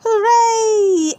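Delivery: loud, high hooray; cheer. Acted. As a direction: cheer it out like a crowd. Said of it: "Everyone cheers".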